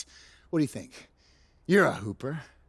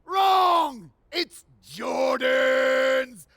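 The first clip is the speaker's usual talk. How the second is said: triumphant shout; 7 semitones higher, much slower than usual. Acted. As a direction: bellow the winner like a champion. Host's big number-one reveal.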